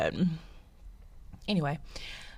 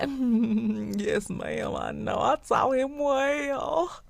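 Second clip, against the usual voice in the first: slow, drawn-out proud mother reply; lightly voiced proud mom accepting praise; proud mama; 5 semitones higher, much slower than usual. Mother answering a compliment, mildly proud drawl. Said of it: "And I'll be like".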